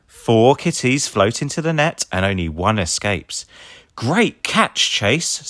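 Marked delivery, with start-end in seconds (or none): 3.9-5.4 praise. A narrator's reading. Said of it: "says Ryder".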